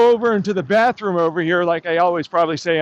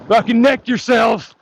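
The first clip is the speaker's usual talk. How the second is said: ordinary talk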